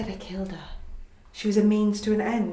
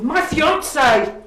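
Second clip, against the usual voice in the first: high, loud, drawn-out outburst; furious correction; 9 semitones higher, 10 dB louder, slower than usual. Acted. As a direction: stretch the words out at full volume. Taunts him about the affair.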